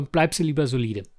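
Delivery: usual speaking voice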